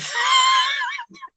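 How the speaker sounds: a high, squealing laugh. Real, not acted. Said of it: "What are you laughing at?".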